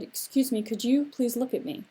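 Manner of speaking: ordinary delivery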